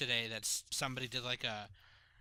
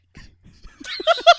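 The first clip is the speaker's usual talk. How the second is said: high laugh; 18 dB louder than usual. Real, not acted. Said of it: "Grandpa, is that you?".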